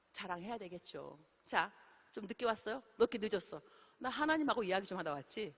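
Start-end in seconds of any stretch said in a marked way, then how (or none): none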